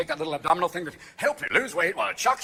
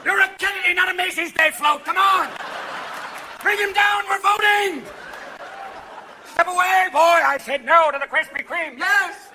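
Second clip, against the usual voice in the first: high, loud comic hollering; big high-pitched yell; shouted exclamations; 10 semitones higher, 5 dB louder, slower than usual. Acted. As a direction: holler it like a crowd-stirring order. Acted heckling and shouted orders.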